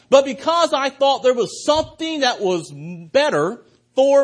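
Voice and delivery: full-voiced altar-call preaching; preaching at full force. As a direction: drive the appeal home to the congregation. Preaching climax of the sermon.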